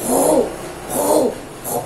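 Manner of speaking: Ru repeated with a strong throaty R, loud; strong R syllable drill. Shown as a demonstration. Teacher drilling the strong R sound for children. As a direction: drill Ru, ru, ru with a hard back-of-throat R. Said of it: "Ó o som, ó o som".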